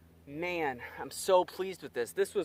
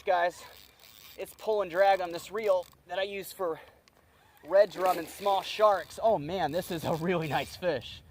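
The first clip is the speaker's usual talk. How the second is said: usual speaking voice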